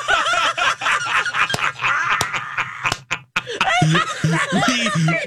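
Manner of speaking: shrieking laughter; long high-pitched laugh; high laughing fit. Real, not acted. Burp cracks everyone up.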